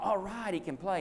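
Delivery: put-on high, hopeful child's cheer; a kid's spirits lifting at last; eager boy. Boy thinks his dad is finally free to play, high and hopeful.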